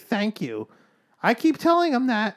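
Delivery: high-pitched mock parent's plea; helpless parent. Retelling what defeated parents say to him, mock helpless.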